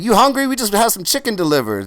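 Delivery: put-on high, loud proud old man. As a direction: announce your famous guests with swagger. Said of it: "And he's like".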